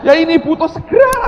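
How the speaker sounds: very high, full-volume yell; acted frantic shout; desperate shouting. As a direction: scream the urgent demand to God. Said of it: "ini sudah teriak-teriak".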